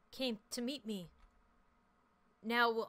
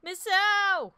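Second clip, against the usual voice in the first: shocked shout; 10 semitones higher, 12 dB louder than usual. Real, not acted. Shocked cry at ghost reveal.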